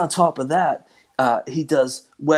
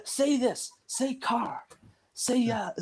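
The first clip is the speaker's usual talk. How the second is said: much higher, softer mimicry of a crowd; class egging him on; 10 semitones higher, 8 dB quieter, much slower than usual. Whole class asking him to say words, high soft mimicry. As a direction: imitate eager onlookers piping up with requests. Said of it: "it was the whole class".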